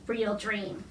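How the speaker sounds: ordinary delivery, nothing marked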